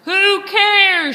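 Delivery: high, loud impatient yell; fed-up pirate shouting. Acted. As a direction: holler it over the argument. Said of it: "shouted a third".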